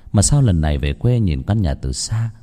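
plain reading voice

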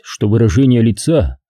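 plain reading voice

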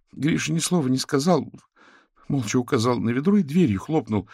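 put-on a high, distraught young woman pleading; upset young wife. A narrator's reading. Katerina tells the investigators about the bouquets, distraught. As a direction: tumble it out close to tears.

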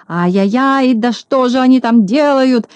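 a narrator's furious outburst; furious shout. A narrator's reading. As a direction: yell in a boiling rage. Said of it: "закричал Клопс, наливаясь от злости кровью".

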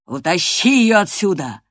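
loud, furious order; storming rage. A narrator's reading. Master orders the girl dragged out, storming. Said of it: "бушевал хозяин".

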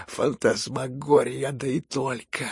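slow, drawn-out astonishment; stunned amazement. A narrator's reading. General stunned by the prince's fortune, amazed. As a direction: draw it out, dumbfounded. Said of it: "почти ошеломлённый от изумления проговорил генерал".

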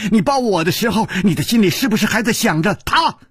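rapid accusations climbing in pitch; jealous outburst. Acted. Jealous woman accusing her lover of thinking of him, agitated. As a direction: pour out the hurt questions in a rush.

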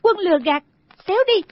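high-pitched female outburst; angry dismissal. Acted. Girl stamping as she drives him off, angry. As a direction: climb sharply in pitch on the last words. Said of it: "Đinh Đan dậm chân nói".

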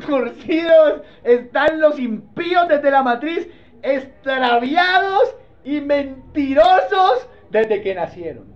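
loud, slow declamation to a congregation; preacher hammering out the verse; emphatic scripture preaching. Acted. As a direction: drive each phrase home from the pulpit. Reads verse forcefully to congregation.

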